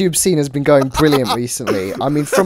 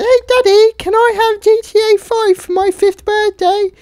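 put-on a five-year-old begging mummy for a game; small child; 5 dB louder than usual.

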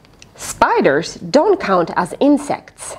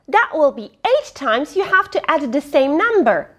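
plain reading voice

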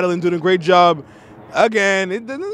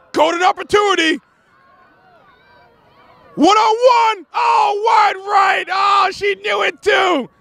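building into high, drawn-out shouts; excited play-by-play; 11 semitones higher than usual. Real, not acted. Commentator calling a one-on-one gone wide, high excited shouting. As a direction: shout it high and stretch the last words. Live call of missed chance.

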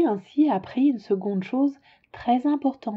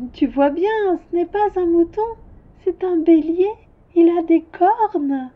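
put-on higher child's voice, patiently correcting; higher child voice pointing out the horns; little prince; 7 semitones higher, 4 dB louder than usual.

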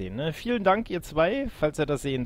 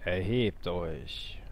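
low, slow evil king; villain king; 6 semitones lower, 6 dB quieter, much slower than usual. Acted. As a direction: command your servants slowly.

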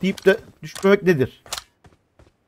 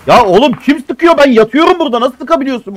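indignant yell; 6 semitones higher, 5 dB louder than usual. Real, not acted. Arguing with teammate in game.

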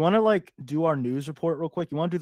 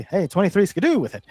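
a touch higher and louder, hammed-up 1920s talker; old-timey slang voice; 5 semitones higher, 6 dB louder, faster than usual. Shown as a demonstration. Host demoing how gray-weather folks talk, mock old-timey voice. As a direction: toss it off like a jazz-age wisecracker. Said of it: "they might talk like this".

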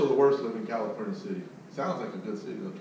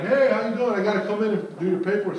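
higher and louder, chipper sales pitch; cheery salesman greeting; 7 semitones higher, 5 dB louder than usual. Retold or imitated. Salesman greeting the wife at the door, chipper. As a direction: win her over with a bright hello.

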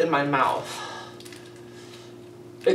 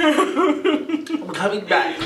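laughing; 6 semitones higher, much slower than usual. Real, not acted. Recovering from the burn.

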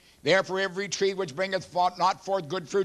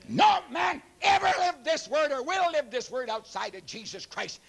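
high shouted proclamation; high ringing preacher shout; preaching shout; 7 semitones higher than usual. Real, not acted. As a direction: cry it out to the congregation.

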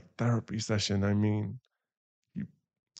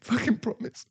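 strained vow; 12 semitones higher than usual. Acted. Desperate emphatic vow.

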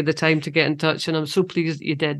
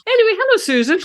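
very high, loud sing-song hello; cheery greeting; 4 dB louder, faster than usual. Real, not acted. Host switching to greet guest Susan, very high and cheery. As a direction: burst into a bright welcome.

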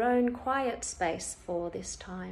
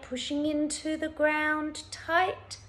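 higher, effortful coaching; urging to tense; 6 semitones higher than usual. Shown as a demonstration. Instructor urging listeners to tighten their hips, urging to tense. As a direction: lift the voice as the squeeze tightens.

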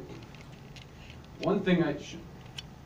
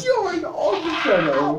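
put-on high, loud, drawn-out British accent; exaggerated British accent; 10 semitones higher, 6 dB louder, slower than usual. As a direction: ham up the British, slow and loud. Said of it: "Every time he goes into that British accent".

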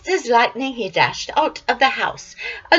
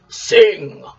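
command; 3 semitones lower, 9 dB louder than usual. Acted. Giant orders harp.

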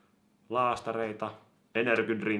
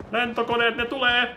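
much higher, louder alarm; alarmed cry; 10 dB louder than usual. Real, not acted. Seeing planes arrive, alarmed cry. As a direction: warn that they're coming.

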